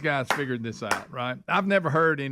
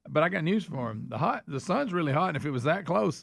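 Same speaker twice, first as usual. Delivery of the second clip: ordinary talk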